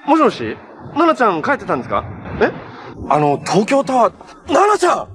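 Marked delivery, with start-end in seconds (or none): 4.5-5.1 high urgent shout of a name; calling out. Acted. Spotting Nana from afar, shouting her name. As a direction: yell her name across the distance.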